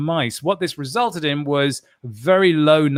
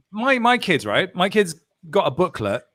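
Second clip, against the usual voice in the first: normal talking voice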